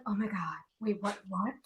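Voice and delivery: retold hushed, low reaction; low and soft, imitating a stunned friend; stunned disbelief. Voicing stunned friend on phone.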